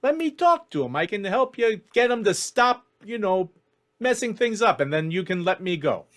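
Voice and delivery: high, pleading elf hustler; eager elf cutting a deal; bargaining elf schemer. Acted. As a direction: talk your way out in a high voice. GM voices tall elf Ellis.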